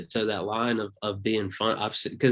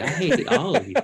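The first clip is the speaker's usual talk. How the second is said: high, laughing "I hate all of you"; laughing mock hatred; 8 semitones higher, 4 dB louder than usual. Comic telling the host he hates everyone, laughing. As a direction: toss it off with a laugh. Joking about hating everyone.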